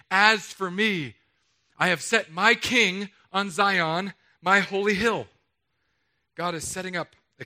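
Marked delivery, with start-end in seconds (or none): 0.1-5.3 slow, louder, weighty declaration; wrathful proclamation. Acted. Preacher voicing God's wrath in a slow, loud proclamation. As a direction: proclaim it like God in fury.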